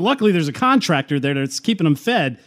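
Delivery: ordinary talk, nothing marked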